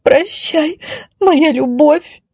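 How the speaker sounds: high, slow, melodramatic goodbye; high-pitched wail of parting; tearful farewell. Acted. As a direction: draw it out like a weeping damsel. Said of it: "всхлипнула красавица".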